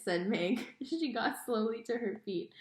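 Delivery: ordinary delivery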